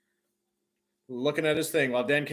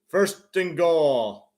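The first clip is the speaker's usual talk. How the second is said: usual speaking voice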